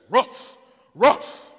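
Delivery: loud, sharp dog bark; talking dog. Acted. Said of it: "He said".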